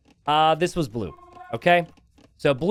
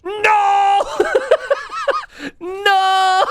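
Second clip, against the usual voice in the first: much higher, shrieking "No!" breaking into laughter; real laughing shriek at a collapse; laughing screams; 5 dB louder than usual. Real, not acted. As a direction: scream and crack up at the disaster. Caster sees a disaster.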